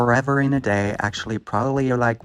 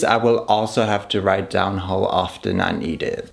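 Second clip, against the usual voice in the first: ordinary talk, nothing marked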